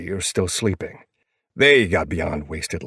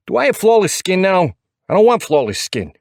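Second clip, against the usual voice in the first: high, loud New York outrage; pitched-up New York fussing; thick New York accent; 12 semitones higher, 6 dB louder than usual. A narrator's reading. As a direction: complain as an indignant little New Yorker. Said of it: "His New York accent is thick and full of attitude".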